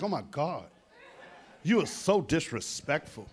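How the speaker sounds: a hushed mock gasp; prim offended woman. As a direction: act scandalized.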